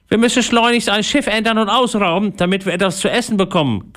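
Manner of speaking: high-pitched, growled pirate voice; growling pirate. Acted. Hungry pirate urging his crew to raid, growling. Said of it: "knurrte Einauge".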